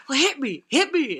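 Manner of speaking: mock a kid egging someone on; goading dare. Brother daring his older brother to hit him, goading.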